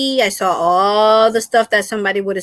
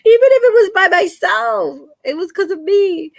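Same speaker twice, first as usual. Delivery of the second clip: high, loud, laughing through it; 11 semitones higher, 4 dB louder than usual. Real, not acted. Laughing at herself to her viewers, high and loud. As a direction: let the laugh spill into the words. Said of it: "I haven't laughed like that".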